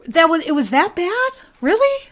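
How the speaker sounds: genuinely offended, can't believe it; high, indignant disbelief; incredulous disbelief. Real, not acted. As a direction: voice shooting up in disbelief on Really. Offended by scrapped city plans.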